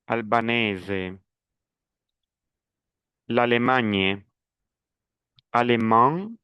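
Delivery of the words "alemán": "Alemán" with a nasal final "an", the n not sounded; nasal vowel. Shown as a demonstration. ("Alemán" con la vocal final nasal)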